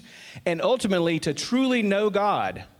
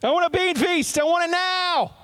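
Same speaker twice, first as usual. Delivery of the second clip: high, shrill spoiled-brat demand; Veruca Salt yelling; 13 semitones higher than usual. Retold or imitated. Spoiled rich girl demanding a bean feast, high yell. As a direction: stamp your foot like a spoiled brat. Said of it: "and she yells".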